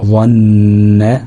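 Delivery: ظن stretched on a level nasal hum; held ghunna. Shown as a demonstration. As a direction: dwell long on the ن in ظن. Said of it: "هنتك على النون شوية". (ظن باتكاء طويل على النون)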